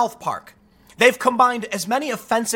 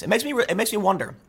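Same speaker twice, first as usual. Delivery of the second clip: ordinary delivery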